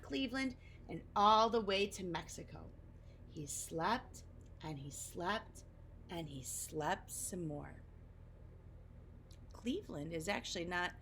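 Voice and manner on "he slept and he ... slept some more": softer and slower each time; drowsy fading. A narrator's reading. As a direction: narrator lulling listeners as Oscar sleeps. Falling asleep narration.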